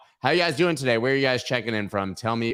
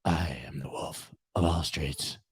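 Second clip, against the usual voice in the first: low, softer superhero rasp; low Batman voice; 6 semitones lower, 7 dB quieter than usual. Pretend. Host shows how Batman would introduce himself, low voice. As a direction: say your name like a brooding Batman. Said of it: "if you talk like this".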